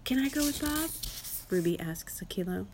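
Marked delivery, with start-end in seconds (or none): 0.0-0.9 put-on high young elephant voice; child asking permission. A narrator's reading. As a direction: ask hopefully, pitched up like a child.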